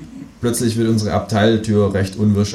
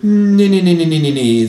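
high, loud repeated nee; kangaroo voice, refusing; 9 semitones higher, 7 dB louder than usual. A narrator's reading. Känguru refuses the narrator's idea, loud and high.

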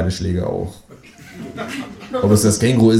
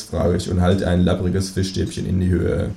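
plain reading voice

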